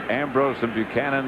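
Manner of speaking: ordinary delivery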